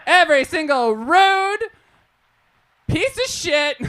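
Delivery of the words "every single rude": ordinary talk, nothing marked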